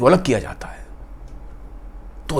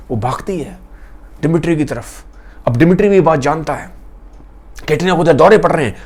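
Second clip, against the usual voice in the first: ordinary delivery, nothing marked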